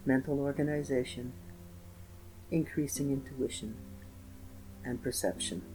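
soothing, unhurried spa-style guidance; guided meditation. Acted.

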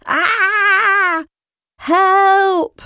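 high, drawn-out cry for help; panicked yell. A narrator's reading. Little frog Lim dodging a boy's bike, panicked yell. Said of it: "yelled Lim".

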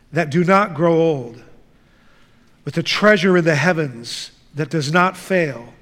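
ordinary delivery

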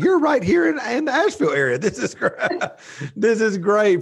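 amazed a guest lives nearby, laughing; excited delighted quote. Retold or imitated. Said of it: "and I'm like".

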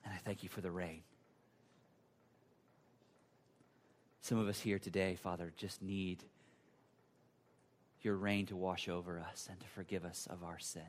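low, soft, hushed prayer; closing prayer. Real, not acted. Pastor leading the congregation in closing prayer, low and reverent. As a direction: pray quietly with long pauses.

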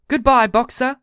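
high, loud cheery call; chorused farewell. A narrator's reading. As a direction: call out goodbye like a crowd. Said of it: "they chorused".

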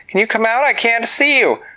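put-on high girl's voice calling to someone hidden. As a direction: coax them out with a high-pitched call. Winona calls to hidden fairy.

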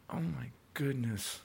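normal talking voice

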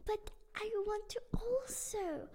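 high, excited begging; girl begging her mom to let her play soccer; eager pleading. Acted. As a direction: beg Mommy, bubbling over. Luna begs mom to play.

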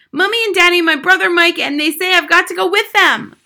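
high, loud frantic answer; distraught child. A narrator's reading. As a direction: wail out who is going. Distraught child reporting news.

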